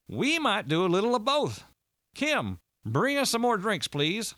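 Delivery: high-pitched, hearty order for drinks; excitable Walter. A narrator's reading. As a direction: call for a round in a high voice.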